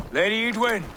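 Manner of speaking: normal talking voice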